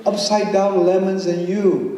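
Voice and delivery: big swoops up in pitch, stretched slow words, laughing; playful poem performance. Acted. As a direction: perform it like a giddy kids' rhyme.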